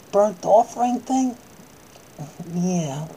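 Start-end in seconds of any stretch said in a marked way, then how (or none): none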